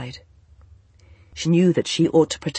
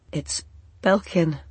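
catch in voice. A narrator's reading. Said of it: "with a catch in his voice".

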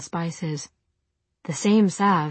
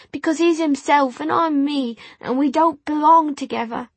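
put-on high, loud tearful servant girl; upset, on the edge of tears; 9 semitones higher, 5 dB louder than usual. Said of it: "her eyes were full of tears".

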